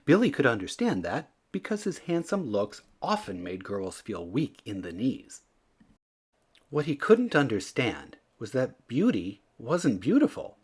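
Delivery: ordinary delivery, nothing marked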